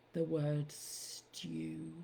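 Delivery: stew drawn out slow and soft; savoured word. Shown as a demonstration. Poem to a podcaster, savouring the word stew. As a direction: linger lovingly on stew. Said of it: "an Irish accent".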